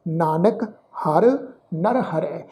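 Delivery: ordinary talk, nothing marked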